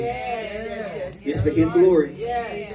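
very slow, each word stretched out; slow drawn-out refrain. Shown as a demonstration. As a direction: drag out It's for his glory for emphasis. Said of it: "repeated refrain, drawn out".

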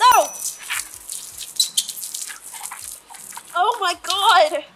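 loud shocked scream. Real, not acted. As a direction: scream when soaked. Drenched with water.

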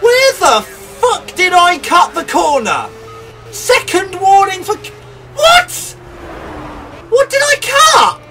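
loud, high furious protest; raging gamer. Real, not acted. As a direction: rage at the penalty. Penalty warning outrage.

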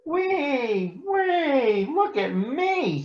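very high, slow, little sing-song kitten voice; tiny singsong voice. Acted. Shy Little Kitten calling to be watched, tiny high singsong. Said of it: "sang a tiny voice".

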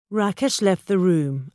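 ordinary delivery

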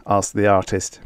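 ordinary delivery, nothing marked

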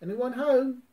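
high, loud call. Acted. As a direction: call it into the dark. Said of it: "cried Pooh".

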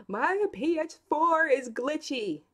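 put-on whiny upset boy.

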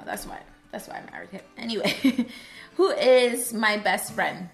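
ordinary delivery

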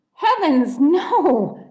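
loud, high exclamation; shocked denial. Acted. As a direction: protest in shock.

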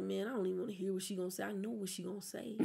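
low, fast, quiet grumbling; quick under-the-breath complaint; reluctant grumble. Retold or imitated. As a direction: mutter it like you dread hearing it. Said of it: "sometimes I be like".

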